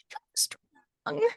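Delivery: tiny creature squeak; very high pitch. Retold or imitated. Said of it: "imagine it has a high-pitched voice".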